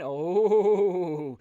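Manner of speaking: usual speaking voice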